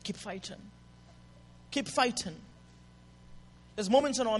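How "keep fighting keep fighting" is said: inner voice. Acted. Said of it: "something inside that tells you".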